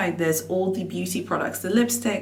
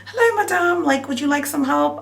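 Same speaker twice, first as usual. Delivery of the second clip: much higher sing-out greeting; imitated sales assistant; 8 semitones higher than usual. Sales assistant offering a browsing customer help, imitated high-pitched. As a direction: pitch it right up, mimicking a shop greeting. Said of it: "this S A says in French".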